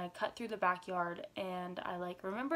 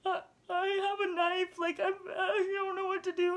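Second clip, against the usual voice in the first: high, loud crying plea; retold bawling; 11 semitones higher, 6 dB louder than usual. Teen on the phone to her dad, high crying plea. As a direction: sob it out to dad, much higher. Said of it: "I'm like".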